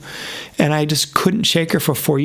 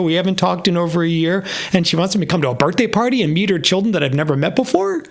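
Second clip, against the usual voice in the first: much higher, laughing, amazed; excited disbelief; 5 semitones higher than usual. Retold or imitated. Reading her text, high with excited surprise. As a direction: squeal the surprise as the door opens. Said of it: "I'm like".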